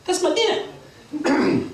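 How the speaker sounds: high, drawn-out bird squawk; Berchicken Hawk. Acted. Hawk claims the sparrow as his dinner, high slow squawk. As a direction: claim it slowly in a high, cocky voice. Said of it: "Berchicken Hawk says".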